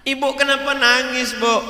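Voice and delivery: high, loud playful questioning; mock questioning cleric. Cleric teasing the crying mother at the wedding, high and loud. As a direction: tease the mother with concern.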